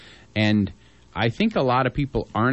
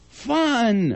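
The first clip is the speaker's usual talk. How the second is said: high excited burst on Fun; 12 semitones higher than usual. Real, not acted. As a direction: toss it out with sudden glee. Hosts listing fair attractions.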